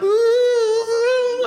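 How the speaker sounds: hammed-up weepy wail; sobbing ooh. Imitating the church lady's sob at the story. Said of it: "She was like".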